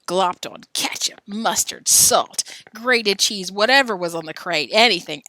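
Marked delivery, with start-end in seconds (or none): none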